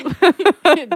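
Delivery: high bright laugh at the video; burst of laughter. Real, not acted. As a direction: laugh out loud at the clip.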